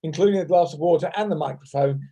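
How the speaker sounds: ordinary talk, nothing marked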